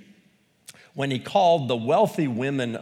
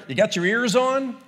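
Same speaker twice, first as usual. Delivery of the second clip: trucker; 9 semitones higher, 5 dB louder than usual. Retold or imitated. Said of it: "the trucker on the highway who says".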